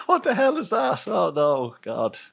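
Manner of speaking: high squeaky shock sinking into dismay; hammed-up horrified squeal; mock horror. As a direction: pretend to be appalled, pitch shooting up then dropping. Acting out horrified discovery of outcome.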